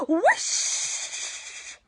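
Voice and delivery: long airy whoosh sound; breathy whoosh. Shown as a demonstration. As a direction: blow it out like wind. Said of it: "And another one".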